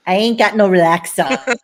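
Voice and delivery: loud sassy comeback. Pretend. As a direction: throw it back with sass.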